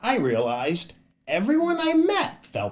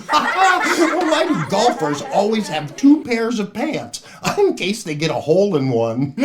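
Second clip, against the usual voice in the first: laughing through a joke; 6 semitones higher, 6 dB louder than usual. Real, not acted. Telling jokes, laughing.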